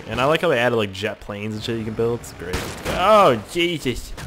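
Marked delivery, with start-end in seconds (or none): 3.0-4.1 blew up voice. Pretend. Blew up voice again.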